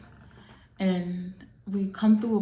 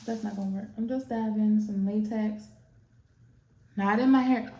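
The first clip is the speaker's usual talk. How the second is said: usual speaking voice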